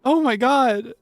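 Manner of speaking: overwhelmed with joy at the changes; tearful delight. Real, not acted. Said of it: "I'm gonna cry".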